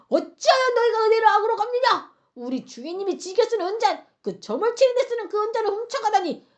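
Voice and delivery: high, loud accusing outburst; thundering rebuke. Retold or imitated. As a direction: scold them as the outraged steward. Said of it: "호통을 막 치면서 말하기를".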